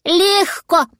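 high, breezy magpie voice; magpie piping up. A narrator's reading. Magpie answering the dragon, high and easy. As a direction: toss it off in a high voice. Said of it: "откликнулась сорока".

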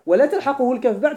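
normal talking voice

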